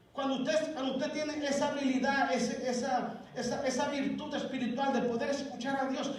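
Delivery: loud, driving preacher's exhortation; fervent preaching. Acted. Pastor urging his congregation, loud and fervent. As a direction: preach it to the whole church at full force.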